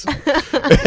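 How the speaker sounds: loud high burst of laughter; real laugh at his own joke. Real, not acted.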